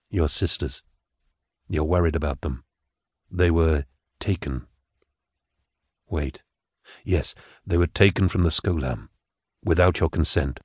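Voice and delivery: low, soft telepathic calm; Gideon's psychic voice. Acted. Gideon reassuring Patience telepathically, low and soft. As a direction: speak gently into her mind, calming.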